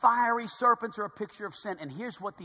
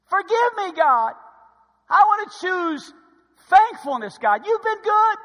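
raised, urgent pleading with God; impassioned repentant prayer; 8 semitones higher, 12 dB louder than usual. Acted. Preacher modeling repentance to God, impassioned prayer. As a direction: confess aloud in a high, pressing voice. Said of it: "Repentance is this".

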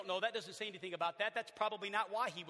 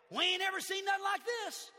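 put-on amazed crowd in a Southern accent; Southern lingo; 13 semitones higher, 6 dB louder than usual. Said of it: "That's just Southern lingo".

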